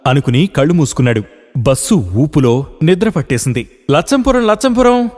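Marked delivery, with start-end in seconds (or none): 3.9-5.1 conductor calling out. Acted. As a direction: call the stop out down the bus. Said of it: "Yellappa కేక వేశాడు". (బిగ్గరగా కేక)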